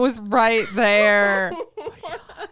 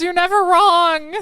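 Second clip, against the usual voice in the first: high, loud, drawn-out dismay; mock lament; 9 semitones higher, 5 dB louder, slower than usual. Listener protesting that Giles cannot be wrong, mock dismay. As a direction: wail it slowly in playful protest.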